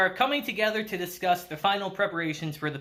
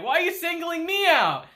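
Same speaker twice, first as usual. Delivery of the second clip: much higher, put-on voice; mock indignant protest; 9 semitones higher than usual. Sudo reacting to being singled out, high mock protest. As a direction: imitate an affronted friend in a high pitch. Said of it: "I like Sudo's expression".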